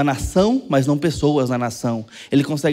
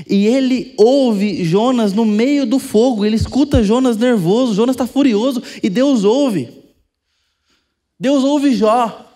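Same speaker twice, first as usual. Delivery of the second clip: loud, high, slow pulpit build; full-force preaching; 5 semitones higher, 5 dB louder, slower than usual. Acted. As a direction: hammer home that God listens. Preaching climax on God listening. (pregação forte, alta e pausada)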